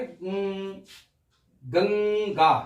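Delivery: गं- held alone, then the full गंगा; split then whole. Shown as a demonstration. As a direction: isolate the nasal गं- before saying गंगा. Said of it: "तो इसकी आवाज क्या रहे?". (पहले गं- अलग, फिर पूरा गंगा)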